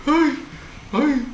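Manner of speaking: two high yelps of fright; real high cries of alarm; startled yelps. Real, not acted. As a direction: yelp twice, caught off guard. Startled in horror game.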